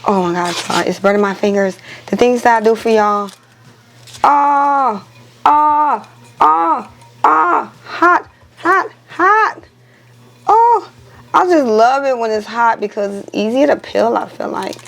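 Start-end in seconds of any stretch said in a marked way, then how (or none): 4.2-10.9 rising pained oh oh hot; pained hot yelps. Real, not acted. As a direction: yelp as fingers burn. Burning fingers on hot tumbler.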